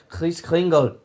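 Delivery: a German accent on Kris Kringle. Shown as a demonstration. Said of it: "how about if I say it in this accent".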